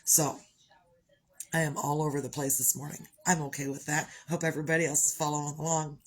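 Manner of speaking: ordinary talk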